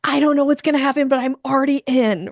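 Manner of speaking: high, fast, eager voice of an excited dog. Retold or imitated. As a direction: play the dog who's all in. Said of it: "They're like".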